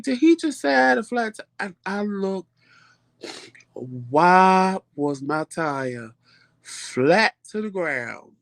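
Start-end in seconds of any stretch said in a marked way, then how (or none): 0.0-1.4 high, quick incredulous question; startled disbelief. Retold or imitated. Startled by stranger's tire remark. 3.7-8.3 very slow, stretched-out words; stunned discovery. Retold or imitated. Discovering the flat tire.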